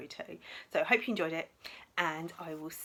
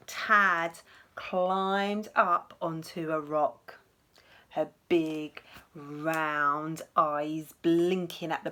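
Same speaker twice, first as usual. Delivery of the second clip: lively, expressive picture-book reading, higher than her own chat; storytelling to children; 6 semitones higher, 10 dB louder, slower than usual. Acted. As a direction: read it to little ones with wide-eyed drama. Teacher reads picture book aloud.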